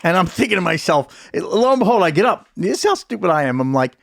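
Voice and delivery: ordinary talk, nothing marked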